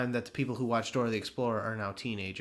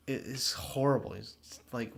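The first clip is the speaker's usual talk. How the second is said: ordinary talk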